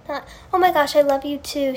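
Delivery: ordinary talk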